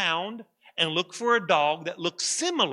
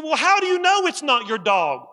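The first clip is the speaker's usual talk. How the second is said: higher and louder exasperated question; 9 semitones higher, 7 dB louder than usual. Acted. Cargo handler snapping back at the owner, louder and higher. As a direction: throw the question back in frustration. Coworker losing patience in argument.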